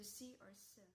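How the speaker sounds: "si" and "s" said as a soft hissing sz; buzzing s. Shown as a demonstration. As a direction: trail "si" into a long s. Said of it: "is pronounced closer to".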